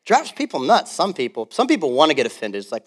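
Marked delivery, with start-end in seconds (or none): none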